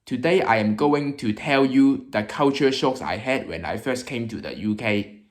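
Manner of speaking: English with a Hong Kong accent. Shown as a demonstration. YouTuber introducing UK culture shocks, Hong Kong accent. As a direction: speak English like a Hong Konger. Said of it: "I will be speaking with a Hong Kong accent".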